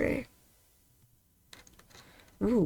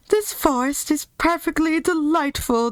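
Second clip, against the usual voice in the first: raised, joyful lion voice; lion admiring the forest happily; lion voice full of delight; 6 semitones higher than usual. Acted. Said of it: "with joy".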